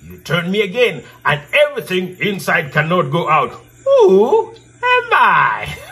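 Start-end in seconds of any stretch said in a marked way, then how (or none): 3.9-5.8 excited. Acted. Riddle teller's playful challenge.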